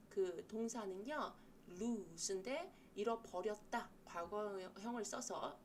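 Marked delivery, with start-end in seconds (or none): none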